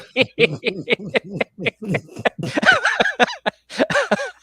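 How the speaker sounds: high, loud burst of laughter; long laughing fit. Real, not acted. Host cracking up at own intro music, long high laugh. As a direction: crack up helplessly.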